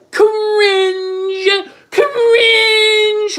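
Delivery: high, long-held cry of cringe; high sustained holler; drawn-out jeer. Real, not acted. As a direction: wail it out, loud and stretched. Reacting loudly to cringeworthy texts.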